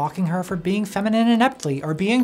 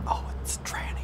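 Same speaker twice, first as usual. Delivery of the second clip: whispered aside; 7 dB quieter than usual. Retold or imitated. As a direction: breathe it without voice. Harasser realizing, under breath.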